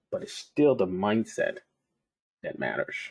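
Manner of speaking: ordinary talk, nothing marked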